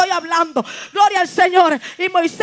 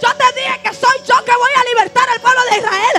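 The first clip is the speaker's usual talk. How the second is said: stern, higher and louder divine rebuke; angry God rebuking; 6 semitones higher, 5 dB louder than usual. Acted. God rebuking a reluctant Moses, angry shout. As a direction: scold him from on high, growing to a shout. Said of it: "el Señor se enojó".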